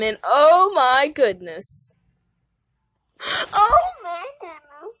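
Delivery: high, crying with laughter; laughing breakdown. Real, not acted. Narrator losing it laughing at the story, high and teary. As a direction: squeal I'm done through helpless laughter. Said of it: "I'm done".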